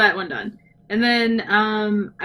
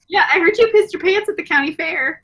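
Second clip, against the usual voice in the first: mock taunt; 8 semitones higher than usual. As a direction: shout the jab at your friend playfully. Teasing callback, mock taunt.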